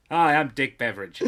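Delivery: lightly voiced TV host. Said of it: "I'm too stuck on Dick Beveridge".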